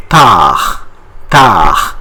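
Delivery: tard with no final D; silent D. Shown as a demonstration. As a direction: end "tard" on a French R, D silent. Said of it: "We don't pronounce once again D".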